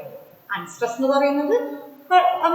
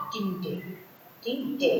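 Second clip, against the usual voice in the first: "tinte" let drop, soft and lower; unstressed syllable; 6 semitones lower, 9 dB quieter than usual. Shown as a demonstration. Teacher scanning a poem line, "tinte" left unstressed. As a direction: say "tinte" lightly, falling away. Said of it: "shown with a stress there".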